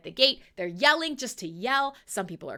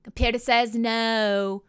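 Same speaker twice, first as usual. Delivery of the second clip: slow, lightly done deadpan clerk refusal; mock a bored clerk flatly refusing; a light imitation of a deadpan clerk; computer says no; 8 dB louder, slower than usual. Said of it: "they do the little, like".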